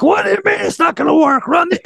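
high-pitched mock outraged coach; retold sideline freak-out, voice shooting up; losing it. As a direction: imitate a coach flipping out. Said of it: "I lost my mind on the sideline".

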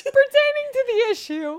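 high, exaggerated mock of her formal reading. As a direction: parrot the stiff formal phrase, teasing. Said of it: "I do be efficient in the way I speak".

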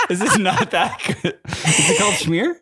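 baffled question through giggles; laughing. Real, not acted. Husband questioning the correction, laughing.

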